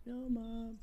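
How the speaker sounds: put-on high-pitched kid voice; child voice. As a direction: play a defiant little kid, high. Child and mom scissor skit.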